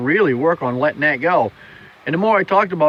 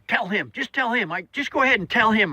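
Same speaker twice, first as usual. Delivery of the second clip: imitating an old lady, higher and pleading; urging old lady; 8 semitones higher than usual. Elderly lady urging her friend to tell the story, higher mock voice.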